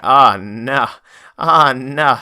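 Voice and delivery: loud, drawn-out groaning no; hammed-up alarm; mock dismay. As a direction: play up the dread, loudly. Acted dismay at incoming enemy.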